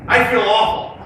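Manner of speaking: loud, high, upbeat cheer; big smiles. Shown as a demonstration. Coach has students repeat gloomy words, grinning and elated. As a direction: belt it out joyfully, against the words. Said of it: "big smiles on your face".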